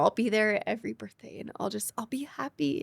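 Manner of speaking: reliving the moment, voice breaking to a whisper; tearful whisper. Retold or imitated. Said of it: "and I started crying".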